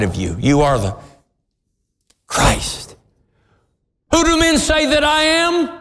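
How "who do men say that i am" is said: high, ringing question; voicing Jesus. Preacher voicing Jesus asking the disciples, high ringing question.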